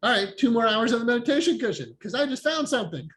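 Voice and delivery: mock eager resolve. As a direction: play it like good news you just found. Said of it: "And then I'm like".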